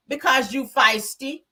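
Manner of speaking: slow, sassy put-down; dismissive sass, drawn out; with an attitude. Retold or imitated. Tisha sassing Nell at the party, with attitude. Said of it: "says with an attitude".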